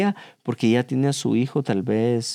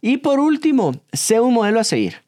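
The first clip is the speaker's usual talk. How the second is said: upbeat announcement; 9 semitones higher than usual. Real, not acted. Announcing final tip.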